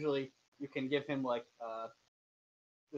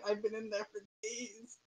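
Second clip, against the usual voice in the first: high, thin, soft pitiful bug voice; high whimpering bug; 6 semitones higher, 5 dB quieter than usual. Acted. The game master voices a crushed bug that was swallowed, whimpering softly. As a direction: whimper it like a tiny trapped bug. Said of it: "a common voice".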